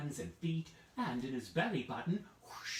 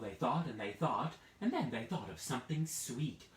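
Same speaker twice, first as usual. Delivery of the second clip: ordinary delivery, nothing marked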